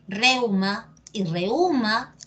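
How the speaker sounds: REU-ma, then re-Ú-ma, slowly; first reuma on E, second on a drawn U; two accepted stresses. Shown as a demonstration. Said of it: "depende de ti cómo lo quieras decir".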